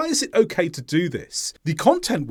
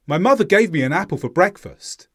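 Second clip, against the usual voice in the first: quick, with small words weakened and linked; faster connected speech; 3 semitones higher, 4 dB louder, faster than usual. Shown as a demonstration. Teacher demonstrates the faster way to learners, quick and connected. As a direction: run the words together swiftly. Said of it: "The second way was faster".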